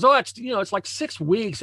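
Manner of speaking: hammed-up grumbling client; imitating a moaning client. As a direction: whine it out like a sulking client. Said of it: "And he's all moaning".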